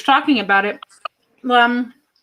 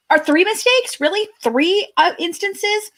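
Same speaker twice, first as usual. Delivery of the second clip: high incredulous questions; mock disbelief, pitched high; 10 semitones higher than usual. Real, not acted.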